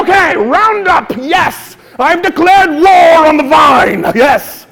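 loud, slow, triumphant battle declaration; mock war cry. Buying weed killer, hamming up a loud victory cry. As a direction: declare war on the vine like a general.

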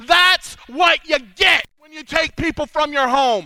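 Daniel gloating at the fallen king; vindictive shout. Retold or imitated. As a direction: rub it in the king's face. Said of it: "go over there and like".

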